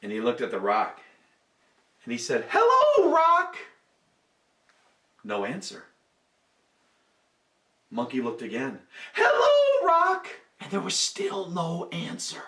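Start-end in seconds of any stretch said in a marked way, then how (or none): 2.5-3.6 bright high call to the rock; high monkey greeting. A narrator's reading. Monkey testing the suspicious rock, high sing-song call. 9.1-10.3 same high sing-song hello; high monkey greeting. A narrator's reading. Monkey trying the rock a second time, high call.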